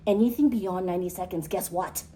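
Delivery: ordinary talk, nothing marked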